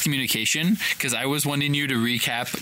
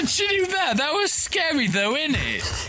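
laughing. Pretend. Laughing, high silly voices.

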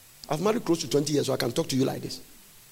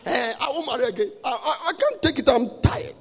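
exasperated; 6 semitones higher than usual. Retold or imitated. Quoting a spouse fed up with marriage.

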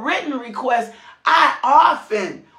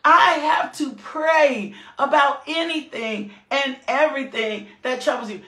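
normal talking voice

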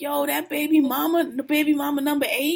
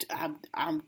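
whisper; 8 semitones lower, 10 dB quieter than usual. Retold or imitated. Retold fed-up aside to guy.

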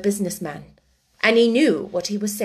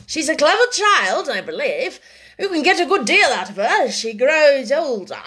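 lightly voiced gentleman, raised and emphatic; unruffled businessman; 5 semitones higher, 5 dB louder than usual. A narrator's reading. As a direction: press the advice with a lilting emphasis.

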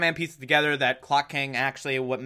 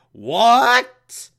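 surprised villain; 8 semitones higher, 7 dB louder than usual. Retold or imitated. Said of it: "Clock King's like".

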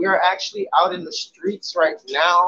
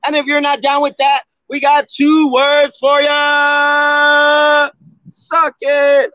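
booming wrestling ring announcer; grand entrance announcement; 7 semitones higher, 4 dB louder, much slower than usual. Pretend.

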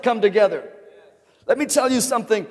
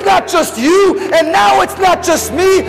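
high, loud preacher's climax; preaching at full force; 7 semitones higher, 5 dB louder than usual. Acted. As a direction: shout it out like a revival preacher. Preaching builds to a shout.

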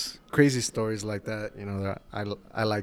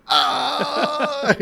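high, loud drawn-out cry; imitated yelp of pain; 8 dB louder than usual. Barber re-enacting his coworker getting towel-snapped, mock pained scream. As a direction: mimic the friend's pained scream. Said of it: "you just hear Billy go".

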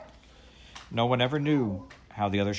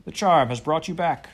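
high, loud joyful greeting; excited; 7 semitones higher, 8 dB louder than usual. Acted. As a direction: cry out in relief. Sailor overjoyed at children's return.

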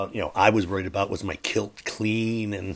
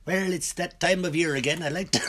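trying an Irish accent; 9 semitones higher than usual. Shown as a demonstration. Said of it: "I'm trying this Irish accent".

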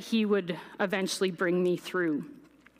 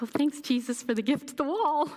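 mock grateful; 6 semitones higher than usual. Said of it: "I wasn't like".

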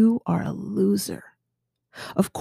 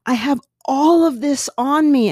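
inner scream; 7 semitones higher, 4 dB louder than usual. Retold or imitated. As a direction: cry out to be seen. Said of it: "you're, like, almost screaming inside".

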